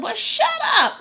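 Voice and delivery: high-pitched mock yell at a child; snappy retort. Mother to son who can't hear, mock scolding. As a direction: fire it back high, half joking.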